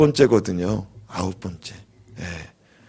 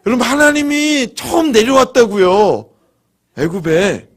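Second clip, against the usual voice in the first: high, loud, laughing exclamation; excited exclaiming; 8 semitones higher, 5 dB louder than usual. Real, not acted. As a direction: burst out with laughing amazement. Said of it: "놀라질 않네".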